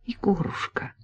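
softly, dropping to a breathy whisper; tender thanks. Acted. Polina softly thanks Georgy, tender whisper. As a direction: thank him gently, fading into a whisper.

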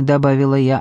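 ordinary delivery

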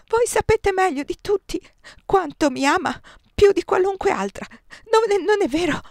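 high, loud, desperate plea; the empress begging Désirée, high and frantic; hysterical pleading. A narrator's reading. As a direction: clutch at her for reassurance. Josephine's near-hysterical pleading.

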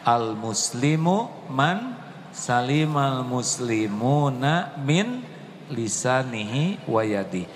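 usual speaking voice

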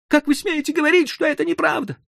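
high, pleading, desperate voice; frantic protest. A narrator's reading. Hoffmann protesting to the crowd, frantic and high. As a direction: hurl it at the doctor, frantic.

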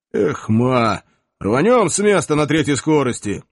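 loud, hearty Cossack whoop; rally him with a shout; rousing cry. Acted.

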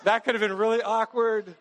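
mock relief.

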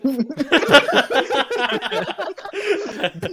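laughing. Real, not acted. Laughing while finishing sentence.

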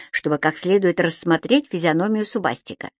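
ordinary delivery, nothing marked

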